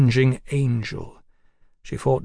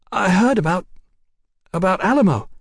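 high, halting woman's voice; desperate woman; 7 semitones higher, 4 dB louder than usual. A narrator's reading. Woman desperately remembering Houston's speech, halting. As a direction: grope for the name, pitched up. Sybil desperately recalling a name.

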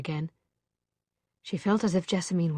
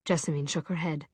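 ordinary delivery

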